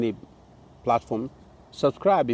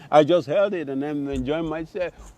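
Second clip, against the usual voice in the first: usual speaking voice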